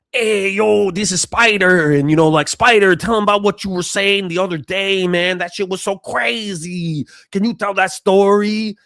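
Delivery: animated Chicano street voice. Retold or imitated.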